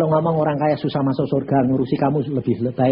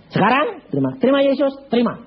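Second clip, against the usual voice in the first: urgent call; 11 semitones higher than usual. Retold or imitated. Pastor urging the rich man to accept Jesus, insistent. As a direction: push him to accept now.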